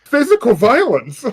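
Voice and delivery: loud, high, delighted outburst; excited exclamation. Real, not acted. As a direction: shout it in mock horror. Player exclaims at the punch.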